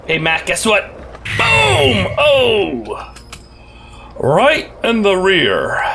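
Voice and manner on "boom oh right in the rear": sharp high "Boom!" then a slow mock taunt; gleeful gloat. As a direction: crow over a hit on your buddy. Gloating at Mac in game.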